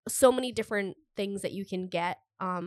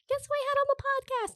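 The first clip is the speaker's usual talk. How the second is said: excited. Retold or imitated. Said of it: "I was like".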